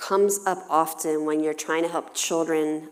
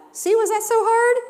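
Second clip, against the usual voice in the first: much higher and louder, teasing delight; high, loud, sing-song mom; 9 dB louder than usual. Retold or imitated. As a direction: cheer the kid's honest answer. Mom praising child.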